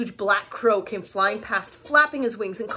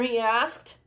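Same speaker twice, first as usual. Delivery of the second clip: ordinary delivery, nothing marked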